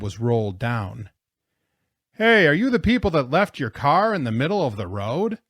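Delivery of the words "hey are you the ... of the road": loud, higher-pitched hail; calling out. A narrator's reading. Plow driver to stranded travelers, calling out. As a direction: holler from a truck window.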